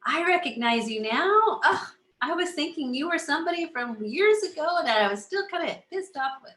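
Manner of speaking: high, lively surprised inner voice; high, playful mock surprise; mock surprised recognition. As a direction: act out spotting an old foe. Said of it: "Then we come back and we say".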